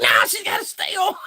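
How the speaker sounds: bossy playful reply; high cartoon retort; high mock voice. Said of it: "I was like".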